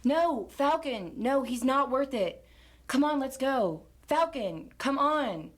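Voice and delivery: frantic restraining plea to Falcon; urgent pleading. Acted. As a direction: hold him back from the fight. Pulling Falcon away from fight.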